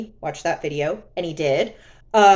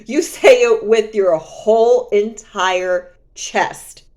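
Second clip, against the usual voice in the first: slow and loud with a laugh; mocking ex through laughter, drawn out; laughing taunt; 7 dB louder, slower than usual. Real, not acted. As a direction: laugh while daring him.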